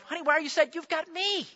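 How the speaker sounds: a lightly teasing husband being oblivious; clueless husband. Retold or imitated. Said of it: "that's what I say to my wife".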